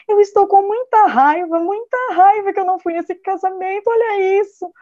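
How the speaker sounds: high-pitched crying-child imitation; imitando filha chorando. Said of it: "ela começou a chorar, chorar". (imitando choro de raiva da filha, bem agudo)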